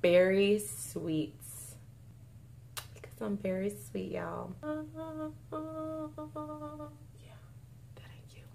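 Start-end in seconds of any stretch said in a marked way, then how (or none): 2.9-4.5 very soft, coy little aside. Real, not acted. Vlogger jokingly praising herself to viewers, coy quiet aside. 4.6-8.5 barely audible whisper; whispered aside. Real, not acted. Vlogger undercutting her own cute joke, whispered aside.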